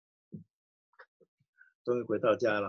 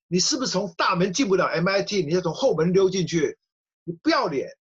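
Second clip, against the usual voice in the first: higher, louder sneering jab; mock her like a schoolyard bully; shameless! spat out scornfully; mocking taunt; 5 semitones higher, 4 dB louder than usual. [name] taunting the daughter.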